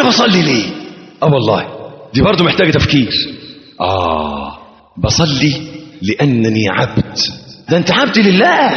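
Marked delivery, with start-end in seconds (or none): none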